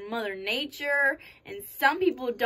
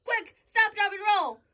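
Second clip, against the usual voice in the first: urgent shout; 8 semitones higher, 4 dB louder than usual. Acted. Urgent fire drill command.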